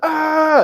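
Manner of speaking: startled yelp. Retold or imitated. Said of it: "and I was like".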